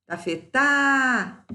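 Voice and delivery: "tafetá" with a long, high "tá"; final syllable drawn out. Shown as a demonstration. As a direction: stretch out the last syllable of "tafetá". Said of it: "Sílaba tônica é a última".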